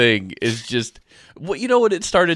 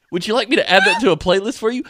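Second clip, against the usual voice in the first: fast, high chirpy assistant; high, quick mock helpfulness; 6 semitones higher, faster than usual. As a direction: rattle off an unwanted offer like a smart speaker. Voices the chatty device.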